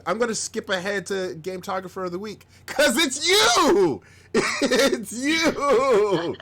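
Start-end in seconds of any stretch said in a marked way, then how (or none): 2.7-6.4 high, loud, drawn-out cry of joy; excited announcement. Real, not acted. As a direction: shout it out like revealing a big surprise winner. Announces Brett as winner.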